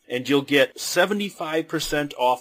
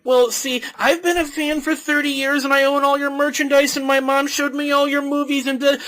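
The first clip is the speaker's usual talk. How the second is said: rambling fan; 12 semitones higher, 4 dB louder than usual. Retold or imitated.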